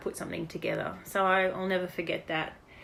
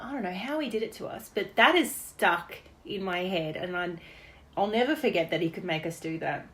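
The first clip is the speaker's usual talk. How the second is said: usual speaking voice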